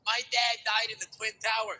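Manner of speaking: high, loud punchline cry; mock proud shout. Would-be comedian answering the casters, high mock shout. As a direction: belt it out as an audition boast.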